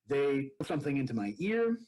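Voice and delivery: usual speaking voice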